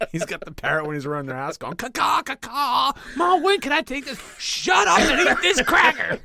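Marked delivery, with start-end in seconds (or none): 1.8-2.9 high, loud slow cawing; mock parrot caw. Little brother in costume cawing loud and high. 3.2-6.2 high, loud comic squabble; mock bickering family. Brother and mother bickering in the costume, high.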